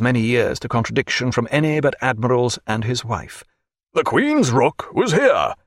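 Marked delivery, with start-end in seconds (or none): 3.9-5.5 slow, firm voice of command; firm captain's voice. A narrator's reading. A lightly voiced captain insists on the rook. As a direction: set him right like a captain. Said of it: "in a strong voice".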